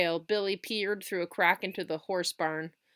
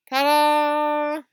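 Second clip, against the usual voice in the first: triumphant; 9 semitones higher, 11 dB louder than usual. Acted. Billy shows off last bite.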